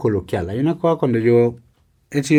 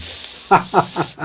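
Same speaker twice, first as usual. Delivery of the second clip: breathy laugh; 7 semitones higher, 4 dB quieter than usual. Real, not acted. Laugh after the story.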